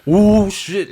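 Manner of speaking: spooked reaction; scared gasp. Retold or imitated. Realizing fear.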